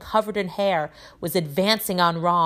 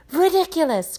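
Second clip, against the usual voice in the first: high-pitched cry; Parvati; 11 semitones higher, 4 dB louder than usual. A narrator's reading. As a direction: cry out the spell, pitched well up. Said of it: "cried Parvati".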